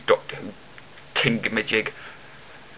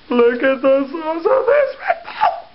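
higher, slow, louder awed voice; amazed put-on voice; 14 semitones higher, 4 dB louder, slower than usual. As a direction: gasp over the huge can. Excited over big drink.

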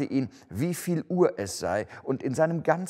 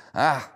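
scornful laugh; 3 semitones higher, 4 dB louder than usual. A narrator's reading.

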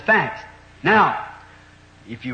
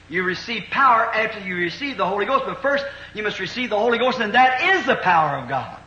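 raised, loud preacher's cadence, building; fervent preaching; 6 semitones higher, 4 dB louder than usual. Acted. Pastor urging the congregation about the Holy Ghost, fervent preaching. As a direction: preach it out with rising fire to the congregation.

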